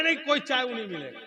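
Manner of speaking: high, fast refusal; mock cross wife. Wife refuses husband his morning tea, cross.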